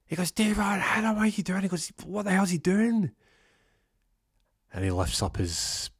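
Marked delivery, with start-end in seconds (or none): 0.3-3.1 very high, louder frantic yells; panicked yelling. Retold or imitated. Cooper frantic as Devon fails to strike, yelling high. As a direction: shriek in disbelief.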